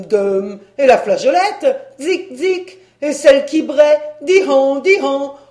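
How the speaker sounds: loud chanted bell noises, ringing and braying; imitating bells. A narrator's reading. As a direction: clang out every bell at the top of your voice. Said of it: "autant de tapage que tous les clochers réunis". (imitation bruyante des cloches)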